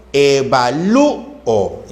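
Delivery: "evalúo" slow, syllable by syllable; hiatus shown. Shown as a demonstration. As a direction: split "evalúo" as e-va-lú-o. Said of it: "hiato acentual".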